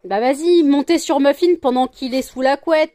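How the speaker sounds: loud sarcastic go-ahead; exasperated protest. Real, not acted. Owner to pets climbing over bed, loud exasperation.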